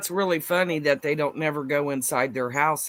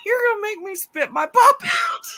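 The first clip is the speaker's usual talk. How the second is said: laughing hard; 6 dB louder than usual. Real, not acted. Friend cracking up at jokes.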